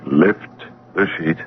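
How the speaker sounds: soft, slow and grave; quiet solemn order. Acted. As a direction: hush it out slowly. Guide orders him, solemn.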